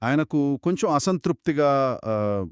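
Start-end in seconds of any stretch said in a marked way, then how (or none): none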